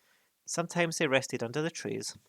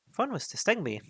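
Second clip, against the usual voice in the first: worried hobbit; 10 semitones higher, 4 dB louder, faster than usual. Acted. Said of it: "he thought".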